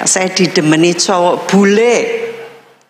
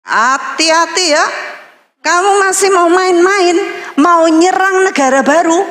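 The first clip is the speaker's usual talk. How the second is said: high, firm warning voice; God warning; 8 semitones higher than usual. Retold or imitated. God warning her not to play around, high firm voice. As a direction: warn her like a stern loving father. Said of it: "Tuhan bilang".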